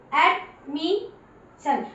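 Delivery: एडमिशन stretched very slowly; very slow demo reading. Shown as a demonstration. Teacher reading aloud for pupils, very slow. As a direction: say एडमिशन syllable by syllable. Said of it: "अब ये देखिए".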